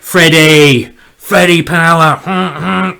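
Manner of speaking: slow, loud drawn-out cheer; Freddy power chant; excited cheer. Real, not acted. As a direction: hype up the little pawn. Cheering on his pawn Freddy.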